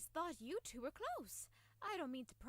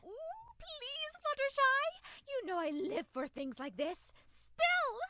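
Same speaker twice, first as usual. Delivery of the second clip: excited pleading; 9 semitones higher, 6 dB louder, slower than usual. Acted. Begging for romantic gossip.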